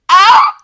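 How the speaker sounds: sudden high, loud "ah!"; short startled scream; startled yelp. A narrator's reading. Carried passenger startled.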